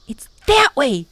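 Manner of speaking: high excited whispered yell; boy telling the others where she is. A narrator's reading. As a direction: call out the direction urgently. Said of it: "Tom yelled".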